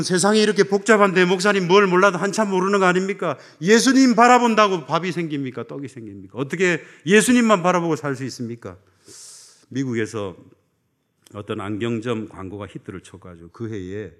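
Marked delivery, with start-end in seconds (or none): 0.0-8.8 louder, higher scoffing challenge to the pastor; mock objector. A churchgoer scoffing at the pastor, loud and indignant. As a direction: throw the skeptic's protest back at him. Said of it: "어떤 분은".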